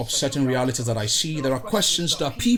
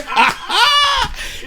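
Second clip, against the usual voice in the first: loud laugh; 7 dB louder than usual. Real, not acted. Laughing after his hype intro.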